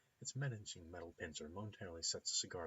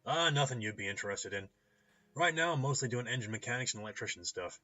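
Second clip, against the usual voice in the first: lightly voiced, louder mechanic; easygoing clinic owner; 4 semitones higher, 8 dB louder than usual. Clinic owner explaining his hobby to a customer, easygoing and louder. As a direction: chat loudly about the side work. Ambrose describes his side work.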